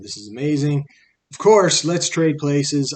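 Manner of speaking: delighted spider. Acted. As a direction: jump at the offer gleefully.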